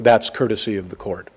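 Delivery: quiet, courteous tone; very calmly. Retold or imitated. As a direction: gently explain to the mother. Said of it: "very calmly".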